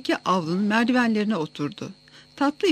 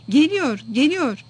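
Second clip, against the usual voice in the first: narrated cry of news, higher and louder; raised-voice announcement, pitched up; excited shout; 5 semitones higher, 5 dB louder, slower than usual. A narrator's reading. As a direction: call it out as a boy bringing news. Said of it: "diye bağırdı".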